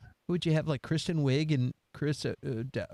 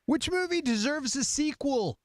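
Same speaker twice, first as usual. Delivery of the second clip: much higher, playful reading; high-pitched question; 9 semitones higher than usual. Pretend. Host reading out the next question, playfully high-pitched. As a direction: read the question up in a high register. Question repeated in three registers.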